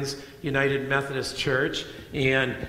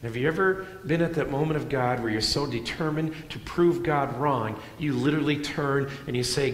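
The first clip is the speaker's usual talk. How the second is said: ordinary talk, nothing marked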